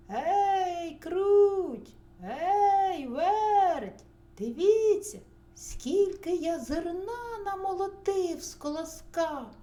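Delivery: high, loud rooster call; hollering. A narrator's reading. Rooster calls mice to see his grain, hollering. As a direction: holler across the yard, proudly. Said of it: "і знову гукає".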